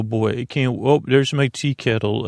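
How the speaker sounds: ordinary delivery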